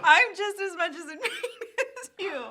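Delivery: high pitched giggling through pain; laughing in pain. Real, not acted. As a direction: laugh between the words. Laughing while mouth burns.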